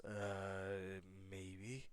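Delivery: low, soft, bashful mumble. Acted. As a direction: admit it shyly under your breath. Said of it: "As Izuku blushed a little bit".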